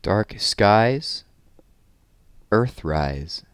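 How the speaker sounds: lively, sing-song read-aloud of a rhyming book; reading to children. Acted. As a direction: read it with bounce for the little ones. Reads rhyming rocket picture book.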